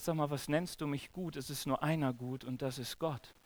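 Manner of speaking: hushed, low-pitched answer; quiet, low retort in a quote; quoting Jesus. Retold or imitated. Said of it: "weißt du, was Jesus ihm sagt".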